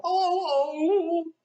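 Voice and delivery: high, loud mock moan; hammed-up high moan; moaning cry. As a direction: wail in dread. Queen moaning.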